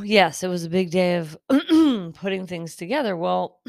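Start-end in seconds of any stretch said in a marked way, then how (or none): 1.4-2.1 loud throat clear; sharp throat clearing. Real, not acted. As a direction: clear the throat hard mid-sentence. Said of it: "I'm gonna cough to the side".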